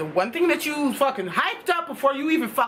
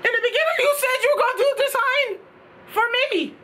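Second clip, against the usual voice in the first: disbelieving complaint; 10 semitones higher than usual. Retold or imitated. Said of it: "I was like".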